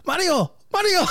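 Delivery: put-on high Mexican-accented call; hammed-up, high Mexican-accent shout; mock Mexican accent. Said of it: "super Mexican when he started talking to Mario Lopez".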